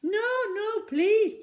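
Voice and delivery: very high, loud frightened plea; high loud panicked begging; pleading. A narrator's reading. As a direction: beg her, terrified. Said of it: "pleaded the newsagent".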